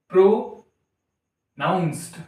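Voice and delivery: "प्रोनाउंस्ड" slowly split as pro, then naunsd; drawn out. Shown as a demonstration. Teacher showing it runs long, "प्रोनाउंस्ड" slowly broken in parts. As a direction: stretch "प्रोनाउंस्ड" in two separated parts. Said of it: "तो काफी लंबा जा रहा है ना".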